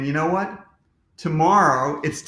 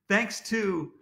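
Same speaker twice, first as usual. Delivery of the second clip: ordinary delivery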